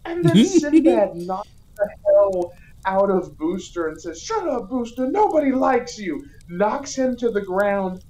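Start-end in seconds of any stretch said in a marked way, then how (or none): 4.2-6.2 high-pitched dismissive put-down; mock scolding. Sinbad putting Booster down, high mock scolding. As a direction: brush him off in a raised acted voice.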